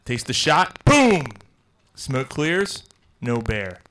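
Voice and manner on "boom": gunshot boom. Acted. Joke narration, gunshot sound.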